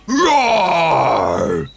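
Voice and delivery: big bellowing ogre roar. A narrator's reading. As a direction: roar to scare the picnickers. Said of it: "he yelled".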